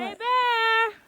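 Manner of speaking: loud high shout; scaring off a bear. Real, not acted. Calling out to scare a bear.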